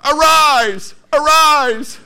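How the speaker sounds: high, loud rousing shout; God's command. Retold or imitated. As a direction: call the fighter up with full force. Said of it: "And God says".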